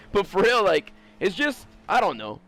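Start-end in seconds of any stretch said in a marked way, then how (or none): none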